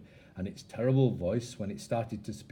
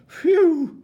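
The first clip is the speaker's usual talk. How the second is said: high, loud sigh of relief; relieved phew; 13 dB louder than usual. Acted. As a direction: blow out a big phew. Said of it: "said the dog".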